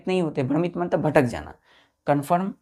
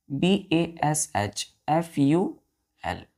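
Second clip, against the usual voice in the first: spelled out; much slower than usual. Shown as a demonstration. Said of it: "teacher spells word for children".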